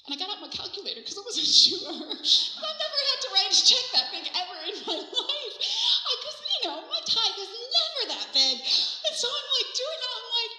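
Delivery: high, loud, laughing through the words; giddy laughter. Real, not acted. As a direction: tell it while bursting with delight. Overcome with giddy laughter telling it.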